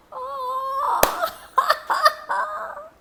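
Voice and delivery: real laugh, high. Real, not acted. Teller laughing at the demon's fate, high laugh. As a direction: laugh at the trick.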